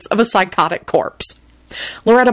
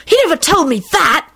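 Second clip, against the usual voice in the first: put-on higher-pitched sulky boy; childish sulk, pitched up; 10 semitones higher than usual. A narrator's reading. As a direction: grumble it like a put-out kid. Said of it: "Tim replied sulkily".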